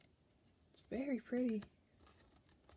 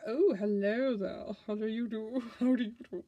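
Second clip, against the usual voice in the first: hammed-up courtly greeting with a swooping "oh"; suave knight voice; 5 dB louder than usual. Knight of Cups greeting someone, suave put-on voice. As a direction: play a smooth gentleman saying hello. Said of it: "I love how I give them voices".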